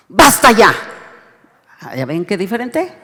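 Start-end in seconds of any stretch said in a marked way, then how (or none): 0.1-0.7 loud, stern "Basta ya" as an angry God; angry command. Shown as a demonstration. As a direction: slam down "Basta ya" with full force. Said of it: "cuando Dios se enoja". ("Basta ya" con enojo, fuerte)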